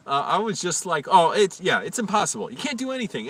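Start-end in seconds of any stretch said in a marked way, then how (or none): 1.1-3.3 high, loud sarcastic outburst; mock yelling. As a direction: yell in exaggerated exasperation. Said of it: "I was just yelling at him".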